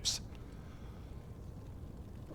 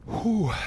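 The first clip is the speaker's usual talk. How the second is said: loud pained whew; 10 semitones higher, 6 dB louder than usual. Real, not acted. Exhaling through chili heat.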